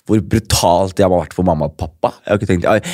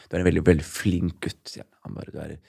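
soft, hushed words of a dying man, lightly voiced; a hint of a frail uncle speaking quietly; 5 semitones lower, 9 dB quieter than usual. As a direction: murmur it gently like a weak uncle in bed. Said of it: "så sier han til meg".

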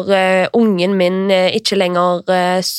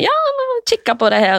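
much higher, fast, eager chirp; mock eager helper; much faster than usual. Aunt offering to help with fourth-grade maths, eager chirp. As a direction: chirp it out, overconfident. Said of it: "og jeg bare sånn".

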